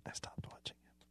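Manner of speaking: whispered aside; whispering. Real, not acted. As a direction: whisper it to the side. Aside to cohost.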